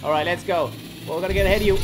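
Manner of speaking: ordinary talk, nothing marked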